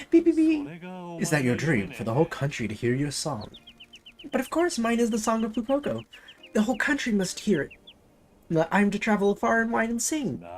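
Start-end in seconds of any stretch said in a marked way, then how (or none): 0.1-0.7 high, loud squeaky beeping; robot beeps. Acted. 4.3-10.4 higher, grand diva voice. Acted. Haughty singer mascot voice.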